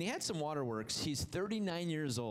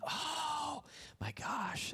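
high, drawn-out, stretched "Oh my gosh"; a frightened cry of realisation; panicked gasp; 13 semitones higher, much slower than usual. Acted. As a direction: brothers gasping as they recognise Joseph, in panic. Frightened brothers realise who he is.

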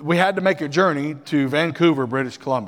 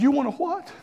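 surprised; 11 semitones higher than usual. Retold or imitated. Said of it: "I said".

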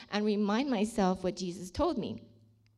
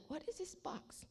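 puzzled whisper; 7 semitones higher, 16 dB quieter than usual. Retold or imitated. Finding mystery box.

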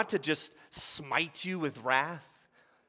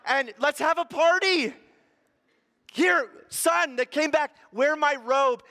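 high and loud, celebrating; joyful father; 10 semitones higher, 6 dB louder than usual. Acted. Father offers his son the robe and ring: high joyful shout. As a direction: give away the robe and ring with delight.

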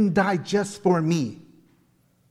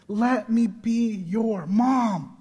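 tender; 6 semitones higher, much slower than usual. Retold or imitated. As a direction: offer it gently like a mother. Slow tender repeat.

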